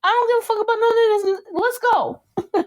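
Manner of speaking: high, rushed, worked-up quote; excited retold outburst. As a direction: race through it high, imitating giddy impatience. Animatedly acting out an impatient quote.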